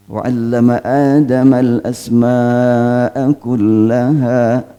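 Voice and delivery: slow, drawn-out recitation in Arabic; measured, sustained verse recitation; Quran recitation. Shown as a demonstration. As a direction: recite the verse with long held vowels.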